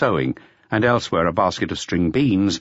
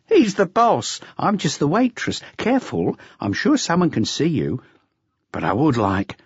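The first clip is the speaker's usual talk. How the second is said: higher, brisk waitress; higher dismissive waitress; 8 semitones higher than usual. Acted. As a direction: brush off the question lightly. Waitress teases customer.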